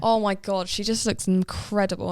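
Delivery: ordinary delivery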